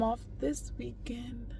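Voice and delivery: soft whispered aside; hushed, happy confession. Real, not acted. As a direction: whisper it like a secret. Confiding good news after sadness.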